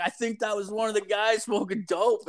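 high, sing-out joking tip-off; teasing call-out. Retold or imitated. Joking tip-off to guard, retold.

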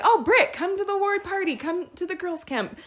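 lightly voiced eager friend; chirpy request. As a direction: ask sweetly, a bit high. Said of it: "And people are like".

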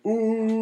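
high, drawn-out mocking "ooh"; teasing group "ooh". Friends razzing a guy about a girl, teasing "ooh". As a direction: hoot it like friends razzing someone.

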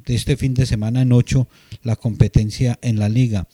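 normal talking voice

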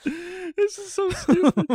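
normal talking voice

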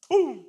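loud, sudden crash noise; explosive vocal bang; imitated crash. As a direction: punch out a booming impact sound. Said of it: "lo estampó así".

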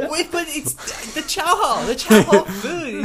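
slow, loud laughing objection; laughing protest. Real, not acted. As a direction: protest while cracking up. Laughing protest about chow hall.

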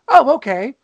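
a breezy, unbothered shrug of a reply; mock-casual acceptance; really casual. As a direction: toss it off like it's nothing. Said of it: "Lisa just goes really casually".

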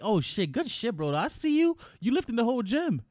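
bright, high gym-bro hype; hype praise. Shown as a demonstration. Demoing how to hype up a lifter at the gym, excited high praise. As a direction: pump him up with big praise, rising high. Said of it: "the easiest way to make friends".

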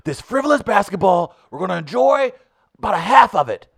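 put-on high loud old-man Bernie call; Bernie doing highlights. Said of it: "Bernie Sanders ... co-host SportsCenter".

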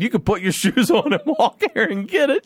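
high-pitched quote breaking into laughter; laughing mock retort. Retelling clerk's comeback to friends, amused and laughing. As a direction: retell the insult, cracking up high.

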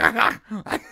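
high guttural alien grunts; harsh nonsense syllables imitating Klingon; Klingon-like noises. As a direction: cough out mock Klingon throat sounds. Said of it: "the language sounds like Klingon".

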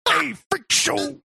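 high, strained wrestler taunt; Randy Savage. Retold or imitated. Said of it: "Randy Savage".

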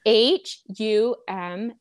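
each letter said slowly and separately; spelled slowly. Shown as a demonstration. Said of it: "Spell humble".

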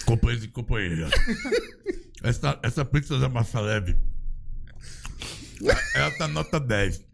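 low Lula voice with a mouthful; Lula impression. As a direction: talk like Lula while chewing pizza. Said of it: "Como é que é o Lula comendo de boca cheia".

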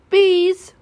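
high, loud hail; calling out. Acted. Pooh calling the bees to come home, crying out loudly. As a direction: call them home at full voice. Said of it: "cried Pooh".

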